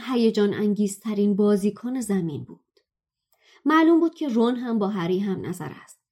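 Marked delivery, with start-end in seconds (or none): none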